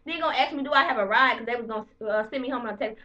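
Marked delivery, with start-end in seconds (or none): none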